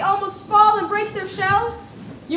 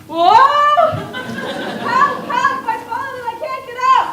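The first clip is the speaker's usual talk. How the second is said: mock cry for help; 8 semitones higher, 4 dB louder than usual. Mock fall.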